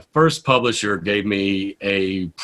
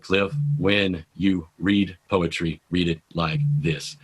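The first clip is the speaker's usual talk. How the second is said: slow, low, softer, word by word; painfully slow; 6 semitones lower, 6 dB quieter, slower than usual. Retold or imitated. Publisher coaching a poet on reading aloud, painfully slow. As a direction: space out every word slowly. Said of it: "it's spoken so pain-, it's so slowly".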